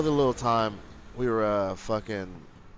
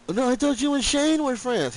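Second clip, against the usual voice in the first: much higher, louder put-on kid voice; mocking a kid's voice; 13 semitones higher, 5 dB louder than usual. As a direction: mimic the boy in a silly high voice. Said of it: "He got the cleft lip".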